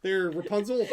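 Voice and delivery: hammed-up impatient fan calling out; mock demanding fan. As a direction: boss the character into doing her dance. Mock ordering character to perform.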